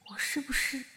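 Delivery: a breathy unvoiced whisper to herself; whispered doubt. Whispering her doubt to herself about Chen Wo'ai. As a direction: whisper the worry so no one hears.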